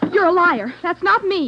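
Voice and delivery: high, loud accusation; angry outburst. Acted. Girl hurls the accusation at the detective, furious. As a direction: snap back at him furiously.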